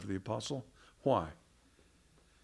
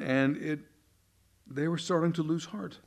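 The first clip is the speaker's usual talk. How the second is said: ordinary talk, nothing marked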